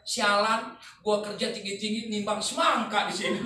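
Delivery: loud exasperated yell about weighing melons; mock shout. Girl at the store yelling over the watermelons, loud and fed up. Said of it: "sambil teriak".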